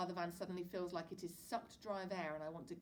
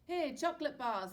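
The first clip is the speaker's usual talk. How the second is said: louder, higher cheery dad; tossing treats, jolly father; 8 semitones higher, 10 dB louder than usual. A narrator's reading. Dad to his boys, loud cheery father voice.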